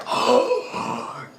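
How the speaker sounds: a high, sharp gasp of awe; shocked gasp. Retold or imitated. The chieftain's people watching Soe's power, a high gasp of shock. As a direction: suck in air like you can't believe it. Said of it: "They go".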